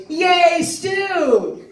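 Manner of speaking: put-on high, drawn-out childlike cheer. Acting Al hearing that dinner is stew, gleeful high cheer. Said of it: "and Al goes".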